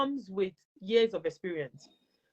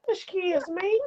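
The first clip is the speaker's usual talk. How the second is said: high, prim excuse me; lightly mocked prim excuse me; mock prim complaint; 11 semitones higher, 6 dB louder, slower than usual. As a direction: play a fussy complainer. Mocking polite protest.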